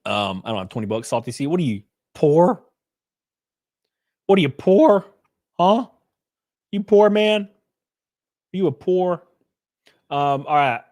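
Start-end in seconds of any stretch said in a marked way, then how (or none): 2.1-9.2 teasing. Real, not acted. Teasing a chatter repeatedly.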